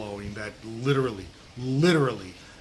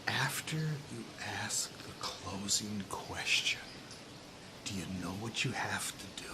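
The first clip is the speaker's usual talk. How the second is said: suspenseful whisper; 6 semitones lower, 14 dB quieter than usual. Retold or imitated. Sales trainer drawing his audience in, suspenseful whisper. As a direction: whisper it to set up a punchline. Hushed build-up to trainer's punchline.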